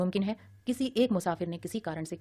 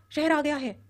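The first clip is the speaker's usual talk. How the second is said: shouting; 8 semitones higher, 4 dB louder, slower than usual. A narrator's reading. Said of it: "ऊंची आवाज में चिल्लाया".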